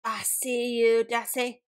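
mild screechy eagle threat; screeching eagle. A narrator's reading. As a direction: draw it out like a hunting eagle. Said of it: "the eagle screeched".

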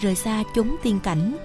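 usual speaking voice

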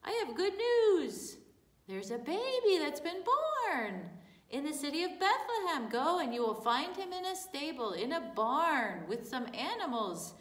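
high, loud angel voice. Acted. Angel announcing the birth to shepherds, high and loud. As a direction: proclaim the good news from the sky. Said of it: "And the angel said".

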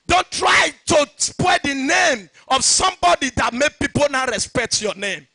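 loud, high, driving pulpit shout; fiery sermon cadence, ringing out; preaching at full force. Acted. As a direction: hammer each line home to the church. Pastor preaching point one.

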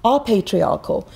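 normal talking voice

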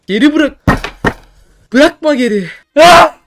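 loud, high urgent yells; panicked shouting. Real, not acted. Panicked game commands.

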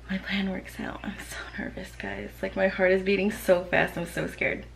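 nervous whispered aside. Real, not acted. Mom whispers to viewers as husband arrives, hushed. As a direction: whisper so he won't hear you. Husband almost home, hiding prank.

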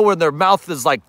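ordinary talk

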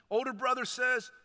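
normal talking voice

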